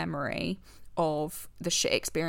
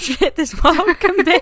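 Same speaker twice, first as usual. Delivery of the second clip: higher and louder, breaking into laughter; laughing; 8 semitones higher, 11 dB louder, slower than usual. Real, not acted. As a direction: laugh through the bleak punchline. Laughing at a bleak remark.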